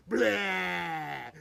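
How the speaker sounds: a hammed-up monster roar, loud. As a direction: roar like a big furry monster to scare kids. Monster introduced, kids story.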